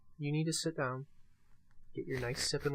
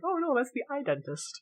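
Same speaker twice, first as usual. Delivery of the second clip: retold thought; 11 semitones higher, 4 dB louder than usual. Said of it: "I'm like".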